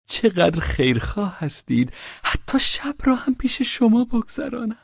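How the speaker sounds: high, ingratiating thanks; fawning husband. Acted. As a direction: gush with fake gratitude. Pavel's grateful reply. (شوهر چاپلوس)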